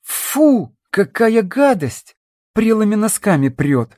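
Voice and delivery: high, squeamish disgust; disgusted girl. Acted. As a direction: recoil from the stink. Girl disgusted by flask smell.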